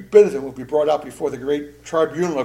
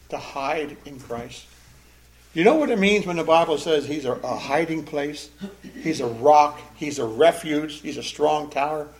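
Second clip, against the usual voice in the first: ordinary talk, nothing marked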